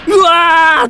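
very loud, very high falling scream; terrified scream. Acted. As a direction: scream as you tumble down. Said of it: "おっさんの叫び声". (恐怖の悲鳴)

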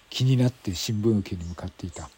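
normal talking voice

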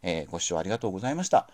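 quick hurried thanks; rushing before cutoff. Real, not acted. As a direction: rattle off the thanks fast. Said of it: "駆け足ですいません". (急いだ早口のお礼)

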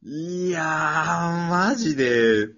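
a loud, stretched-out exclamation of relief; excited outburst. Real, not acted. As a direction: burst out with the relief of a nervy moment. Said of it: "超ハラハラだったのよ".

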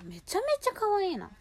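high squealing gush; excited. Real, not acted. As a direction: squeal it with delight. Gushing over pink eyeshadow. (高い声ではしゃぐ)

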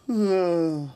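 big loud yawn. Real, not acted. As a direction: yawn out loud mid-sentence. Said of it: "あくびが出ちゃって".

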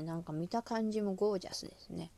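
ordinary talk

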